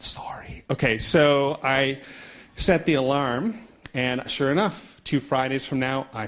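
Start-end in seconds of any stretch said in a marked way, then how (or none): 0.0-0.6 soft, unvoiced aside; hushed apology. Real, not acted. Speaker to the translator after talking too fast, hushed apology. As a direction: slip in a whispered apology.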